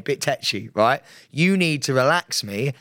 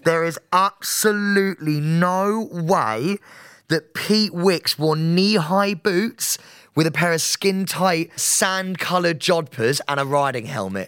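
slow, drawn-out words, pitched up, incredulous; emphatic disbelief; 3 semitones higher, slower than usual. Real, not acted. Refusing to believe co-host wore jodhpurs, slow incredulity. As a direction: spell out your disbelief slowly. Disbelief at co-host's riding outfit.

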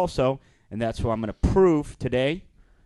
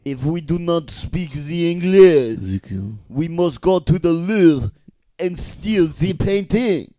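slow, loud French accent; thick, drawn-out mock French; 3 semitones higher, 5 dB louder, slower than usual. Shown as a demonstration. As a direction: scheme like a hammy French thief. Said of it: "Do French".

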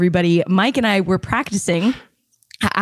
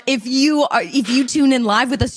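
usual speaking voice

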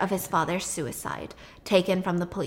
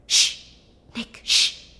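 urgent hush; much slower than usual. Retold or imitated. Brother hushing him in hiding.

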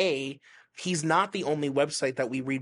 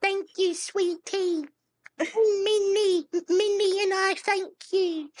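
very high put-on old lady voice; Sharon impression; slower than usual. Thanking a listener in a high Sharon voice. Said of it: "Your Sharon impression is phenomenal".

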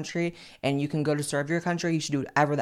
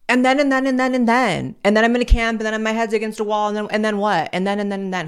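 loud, high, breathless "and then, and then" chain; excited kid rambling; 4 semitones higher, 6 dB louder than usual. Pretend. Mocking slippery slope rambling.

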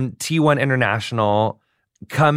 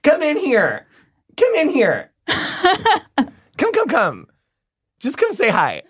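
high, sing-song call to the next room; 10 semitones higher, much slower than usual. Real, not acted. Host calls guest's husband in from the other room, high coaxing call. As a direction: coax him in with a high call.

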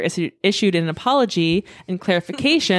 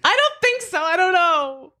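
laughing; 12 semitones higher than usual. Real, not acted. High squeaky laughing uncertainty.

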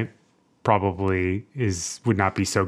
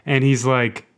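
usual speaking voice

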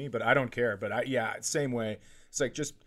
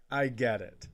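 ordinary delivery

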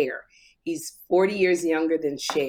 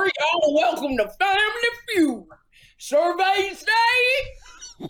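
big, high game-show host voice; Steve Harvey impression; 11 semitones higher, slower than usual. Co-host mimics the host welcoming viewers to Family Feud, Steve Harvey impression. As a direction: draw it out high like a hammy host. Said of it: "Me and Steve Harvey".

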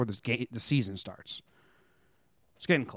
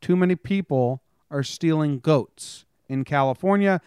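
slow, punchy newsreader delivery with dry jokes; news read; 4 semitones higher, slower than usual. Acted. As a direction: read the headlines like a mock anchor, hitting each story. Reads news headlines.

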